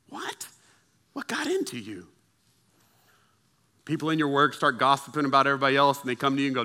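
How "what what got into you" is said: soft, high-pitched disbelief; mock-bewildered buddy; baffled friend. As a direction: squeak it as if aghast. Acted baffled reaction to refusal.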